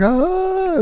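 long, very high held "ah"; mock horrified scream. Horrified child seeing bloody mother.

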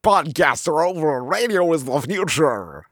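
melting-in-acid radio announcer. Pretend. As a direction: croak it out like a host melting in acid. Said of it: "sounds like you're melting in acid".

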